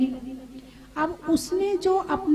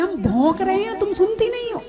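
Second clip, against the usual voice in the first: mock angry complaint; 4 semitones higher than usual. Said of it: "कभी-कभी तो लोग कहते हैं".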